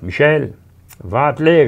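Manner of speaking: high, loud order from a farm mother; commanding mother. Acted. Mother orders Michel to harness the horse, loud and high. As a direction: call the son to harness the horse.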